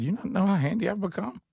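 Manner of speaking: high mock-proud brag; mock boast. Teller brags to mom about his handiness, mock-high boast. Boasting self in retold story.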